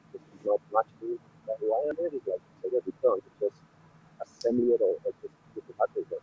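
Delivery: muffled, hollow bad connection; talking down a pipe. Real, not acted. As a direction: voice through a tube. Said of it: "like you were talking down a pipe".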